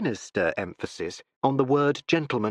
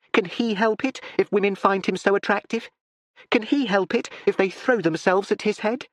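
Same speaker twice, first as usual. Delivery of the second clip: lightly higher, hurried explaining; eager daughter; 7 semitones higher than usual. A narrator's reading. Daughter preparing her father, eager. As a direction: coax him gently toward meeting. Constance defends Tony.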